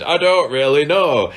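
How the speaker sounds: lightly voiced gruff trucker; a hint of a burly trucker shouting; big burly trucker voice. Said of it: "that wasn't effeminate".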